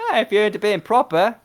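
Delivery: high fast Cockney urchin; quick, cheeky Cockney kid; old london cockney. Acted. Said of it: "my best pauper".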